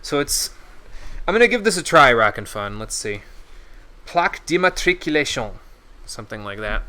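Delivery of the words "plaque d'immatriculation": higher-pitched go at a French accent; attempted French accent. Shown as a demonstration. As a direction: have an earnest, clumsy try at a French accent. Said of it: "my French accent is terrible".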